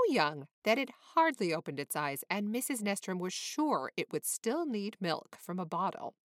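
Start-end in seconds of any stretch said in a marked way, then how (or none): none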